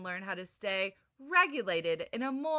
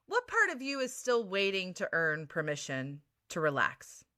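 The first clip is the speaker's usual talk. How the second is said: ordinary talk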